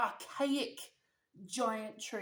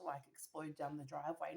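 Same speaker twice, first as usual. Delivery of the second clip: ordinary delivery, nothing marked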